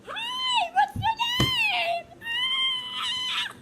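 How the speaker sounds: very high, drawn-out squealing voice; ecstatic young aunt. Acted. Aunt meeting her newborn niece, ecstatic squealing. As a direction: squeal with overwhelmed excitement.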